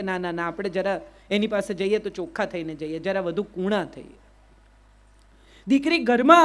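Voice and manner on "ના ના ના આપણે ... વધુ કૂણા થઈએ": softer, lower, gentle musing; as a father melting for his baby daughter; hushed fond reflection; tender thought. Retold or imitated.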